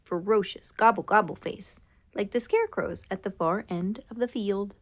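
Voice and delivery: ordinary delivery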